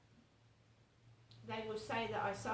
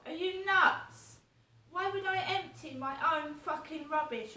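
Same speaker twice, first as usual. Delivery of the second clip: indignant; 5 semitones higher, 6 dB louder than usual. Real, not acted. Ranting at neighbours who framed her, loud indignant disbelief. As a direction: fling the question out in disbelief. Angry rant about rubbish.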